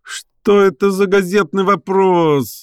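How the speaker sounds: high, drawn-out howl of protest; indignant wail. A narrator's reading. Professor protesting at reporter's question, wailing indignantly. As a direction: wail the question slowly in outrage. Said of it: "завыл Персиков".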